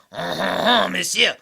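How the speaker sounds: a loud, hammed-up French "Monsieur!"; a playful French exclamation; mock French. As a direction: greet him like a cartoon Frenchman.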